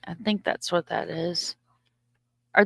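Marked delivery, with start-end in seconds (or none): 0.1-1.6 unsure whispered aside. Real, not acted. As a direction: breathe it almost to yourself. Unsure aside while showing calendar.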